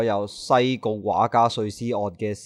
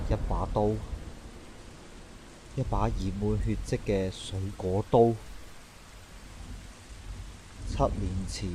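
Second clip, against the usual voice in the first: much softer, lower, dreading; hushed dread; 3 semitones lower, 11 dB quieter than usual. Acted. Detective alone staring at the bloody knife, hushed dread. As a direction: whisper it to yourself over the knife.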